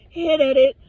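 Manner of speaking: usual speaking voice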